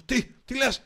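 high, loud outburst of disbelief; retold shout. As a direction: shout it like you can't believe the bad news. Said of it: "και φωνάζω". (φωνή δυσπιστίας, σαν αναπαράσταση)

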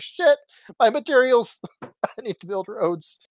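high, drawn-out cry of alarm; mock panic. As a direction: play up the panic, pitched high and slowed down. Acting out his in-game panic.

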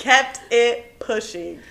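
KEPT drawn out, each word spaced; slow, deliberate repeat. Real, not acted. Repeating the catchphrase to a laughing friend, slow and deliberate. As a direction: stretch out "Kept it pushing".

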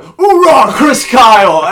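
high, loud soldier's cheer; loud gung-ho soldier. Retold or imitated. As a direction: shout it out like a proud Marine. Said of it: "No, he goes, he went".

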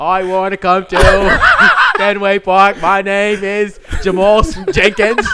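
loud, high, hammed-up Boston accent; mock Boston accent. Banned fan asks into Fenway under an alias, loud Boston accent. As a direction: bellow it in bad Boston, cracking up. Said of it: "Boston accent".

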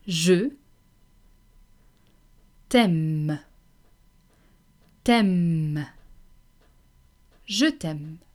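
je t'aime broken up, t'aime repeated with long pauses; slow French drill. Shown as a demonstration. Teacher pausing for learners to repeat je t'aime. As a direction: drill je t'aime slowly, syllable by syllable. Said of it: "Let's hear you say that".

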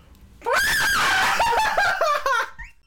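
loud high shrieking laugh; scream-laugh into the mic. Acted.

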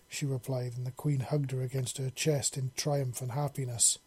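ordinary delivery, nothing marked

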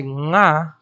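nga nasaal uitgesproken; nasal nga. Shown as a demonstration. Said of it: "Very nasal".

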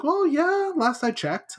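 high-pitched, playful retold reply; mock nonchalance. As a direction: lift the voice high for a comic admission. Dead man's retold sheepish answer.

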